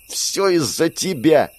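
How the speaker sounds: high, slow mock shout. A narrator's reading. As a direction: hero yells at the ghost, blaming it, in a shout. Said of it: "выкрикнул я".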